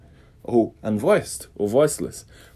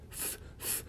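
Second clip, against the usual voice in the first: unvoiced breathy th hiss; airy th hiss; 23 dB quieter than usual. Shown as a demonstration. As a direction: blow a soft hiss through teeth. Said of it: "Você escuta o soprinho".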